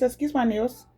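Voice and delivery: ordinary delivery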